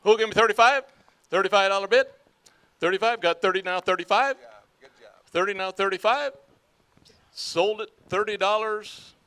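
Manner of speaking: loud, high auction chant; auctioneer. Acted. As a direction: call the bids fast and steady. Auction chant, second item.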